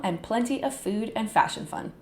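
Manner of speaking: ordinary talk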